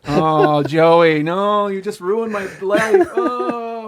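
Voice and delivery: hammed-up loud wailing; mock lament. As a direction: pretend to be devastated, voice climbing. Mock lament after being blamed.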